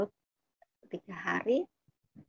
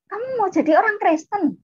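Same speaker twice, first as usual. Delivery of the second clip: high, loud, scandalised question; shocked yell; 12 semitones higher, 5 dB louder than usual. Retold or imitated. Older sibling confronts younger one about faith, shocked high yell. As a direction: demand it in disbelief. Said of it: "Langsung kakak saya teriak".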